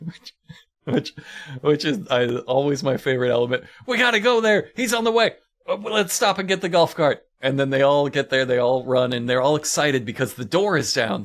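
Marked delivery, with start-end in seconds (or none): 3.9-7.2 mock urgent team. Team racing to trap but wanting carts, hammed-up urgency. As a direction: rush it then lazily change plan.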